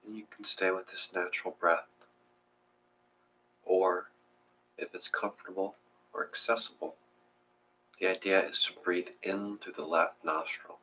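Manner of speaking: soft, hushed meditation voice with long pauses; guided meditation. Acted. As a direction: ease them into the breath, quietly.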